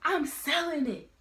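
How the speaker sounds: a high, scolding mom voice; imitating angry mom. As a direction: snap it back like an irate mother. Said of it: "She was like".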